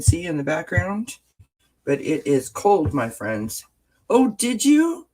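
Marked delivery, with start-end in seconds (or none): none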